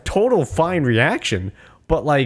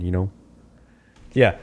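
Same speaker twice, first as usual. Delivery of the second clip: ordinary talk, nothing marked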